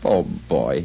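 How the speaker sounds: low, sorrowful pity; a low sigh of sympathy. A narrator's reading. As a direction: feel sorry for your younger self. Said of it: "again in pity for his former self".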